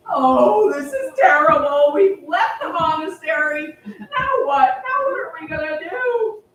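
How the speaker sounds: loud, wailing lament; despairing wail. Acted. Three friends who left the monastery, loud despairing wail. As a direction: cry out in despair. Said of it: "all three of them are there like".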